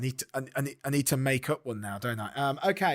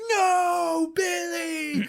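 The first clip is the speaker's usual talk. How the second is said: high, loud wail of grief; hammed-up drawn-out scream of despair; anguished cry; 3 dB louder, much slower than usual. Shakespeare finding his best clacker dead, high mock wail. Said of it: "comes out and he's like".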